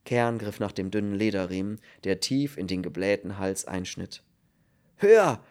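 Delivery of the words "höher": high, loud shout; urgent one-word order; called command. A narrator's reading. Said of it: "rief er". (laut gerufen)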